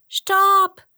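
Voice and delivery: very high, loud mouse cry; brave little mouse. A narrator's reading. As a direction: call a halt in a high squeak. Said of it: "sagte die mutige kleine Maus". (mutiger, hoher Mäuseruf)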